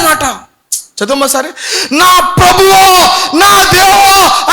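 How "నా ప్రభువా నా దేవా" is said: high, loud, drawn-out cry; Thomas's confession. Acted. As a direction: stretch out Deva in awe.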